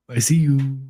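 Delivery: usual speaking voice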